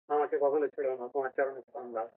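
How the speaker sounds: soft, low devotee's plea; mock tearful plea. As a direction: imitate a weeping devotee, voice sunk low and quiet. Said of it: "কাঁদছে আর বলছে". (নিচু নরম স্বরে ভক্তের কাতর মিনতি)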